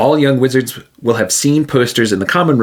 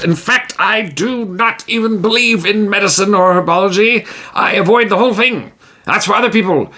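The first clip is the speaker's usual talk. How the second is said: higher, proud and dismissive of herbs; boastful hero; 8 semitones higher than usual. Acted. Tijim boasting to Magnaza he needs no medicine. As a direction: puff up and brush it off.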